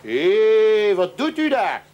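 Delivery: loud "Hé!" from a cop with a lamp; police shout. Retold or imitated. Hague policeman catches a man in the palace garden, loud shout. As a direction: call out like a policeman finding someone in the bushes.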